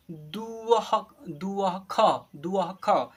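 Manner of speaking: दुअह split slowly, breathy अह then ख; visarga demo. Shown as a demonstration. Teacher showing pupils how to sound out the ः sound. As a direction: spell दुअह out, sounding the अह clearly. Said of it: "क्या पढ़ेंगे".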